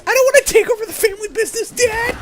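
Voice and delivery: whiny son voice. Pretend. Son protests to his dad in a high, fast whine. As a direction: whine it like a teen refusing his father.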